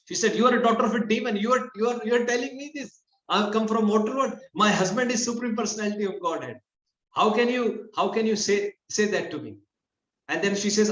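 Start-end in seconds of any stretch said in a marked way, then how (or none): 0.4-9.6 indignant retort. Retold or imitated. Satyabhama defending herself to Mother Sachi, indignant retort. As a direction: protest the insult in a raised, higher voice. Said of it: "Satyabhama got back to her".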